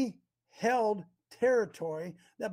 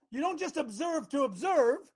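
normal talking voice

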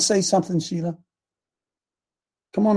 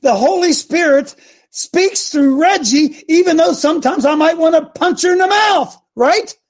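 fervent shout; 9 semitones higher than usual. Real, not acted. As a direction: preach it with fire. Preacher shouting in church.

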